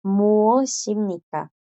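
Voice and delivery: "muoshimnika" said very slowly, every syllable clear; slow sounding out. Shown as a demonstration. Teacher models the formal word for the girls. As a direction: stretch "muoshimnika" out for learners. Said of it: "esta es, chicas".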